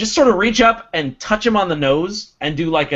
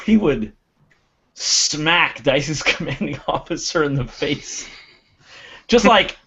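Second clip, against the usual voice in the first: ordinary delivery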